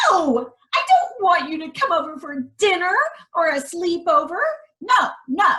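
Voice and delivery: high, fast, loud refusal; princess refusing. Acted. Princess refusing the frog's deal, high and quick.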